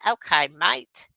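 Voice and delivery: slow, playful Australian twang; mock Australian accent. Streamer kidding Australian viewers about Bluey, mock Australian accent. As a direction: put on a jokey Aussie accent. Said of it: "in Australian accent".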